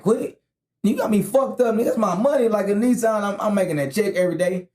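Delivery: high, indignant mock outrage; outraged. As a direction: act shocked and offended, high. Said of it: "I'm like".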